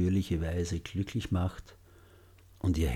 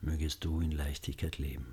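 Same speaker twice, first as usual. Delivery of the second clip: much softer, lower repeat; hushed loving echo; 4 semitones lower, 9 dB quieter than usual. Shown as a demonstration. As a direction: echo the wish very quietly. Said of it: "Wiederholen Sie die Sätze sanft und liebevoll".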